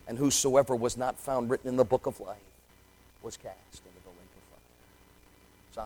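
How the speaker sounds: very soft, dropped low; hushed scripture. Acted. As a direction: read the warning almost in a hush.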